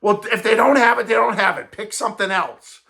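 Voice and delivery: hammed-up mom threatening to leave; impatient mom. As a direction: lay down the ultimatum like a fed-up mother. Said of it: "Mom's going".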